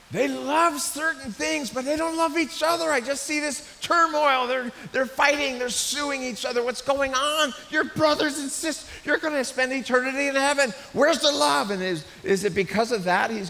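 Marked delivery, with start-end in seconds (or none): 0.1-11.7 loud, high-pitched exasperated plea; exasperated outcry. Acted. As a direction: cry out in dismay at their quarrelling. Voices Paul's dismay at quarrelling believers.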